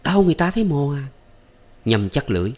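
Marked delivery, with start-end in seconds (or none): 0.0-1.1 high, fast little cry; wincing cry. Acted. Maid squeezed too hard, high wincing cry. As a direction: squeal the complaint quickly. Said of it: "Thắm nhăn mặt kêu lên".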